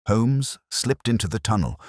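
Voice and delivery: ordinary delivery, nothing marked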